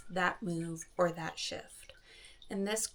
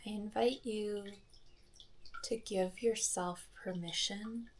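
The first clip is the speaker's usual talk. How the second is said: soft, unhurried meditation voice with long pauses; soft calm phrases, spaced out by silence; guided meditation; 9 dB quieter than usual. Acted. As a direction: guide listeners gently through each breath. Leads a guided breathing meditation.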